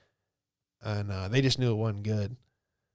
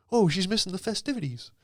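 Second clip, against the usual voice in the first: mocking dad; 7 semitones higher than usual. Mocking dad's corny line.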